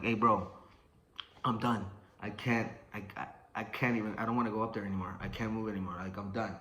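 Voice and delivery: low, soft admission of defeat; spent and giving up. Retold or imitated. Drained boxer wanting to tell trainer, quiet surrender. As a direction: let the voice sink, utterly drained.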